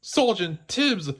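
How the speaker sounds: high, drawn-out relieved call; puppy crying out in relief, slowly; puppy relieved to find the cat. Acted. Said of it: "Lucky cried in relief".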